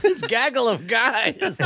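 slow laughing repeat. Real, not acted. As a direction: savour the phrase through a laugh.

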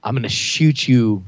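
low, halting deadpan; deadpan threat. Acted. Player warns his partner he'll shoot him, deadpan. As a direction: state it low and deliberately, with pauses. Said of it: "I look at Brian and I say".

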